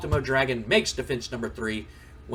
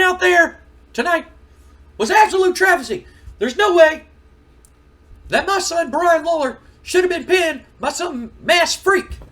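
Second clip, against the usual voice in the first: put-on loud, high, furious old wrestler voice; angry Jerry Lawler rant; 11 dB louder than usual. Jerry Lawler yelling at Shane McMahon about his son, furious. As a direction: bellow it like an outraged wrestling king.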